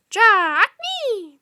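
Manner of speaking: high, loud, harsh bird croak; raven croak. Acted. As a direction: screech it out like a raven. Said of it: "the raven croaked".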